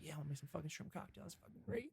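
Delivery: whispered, sarcastic dad quote; hushed, mocking imitation of an annoyed father. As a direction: breathe it out like a grumbling dad.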